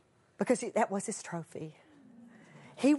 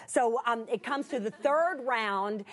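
ordinary delivery

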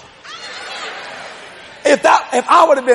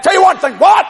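higher, louder challenge; raised quote; 7 semitones higher, 4 dB louder than usual. Retold or imitated. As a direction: call it out sharply. Quarrel with wife retold.

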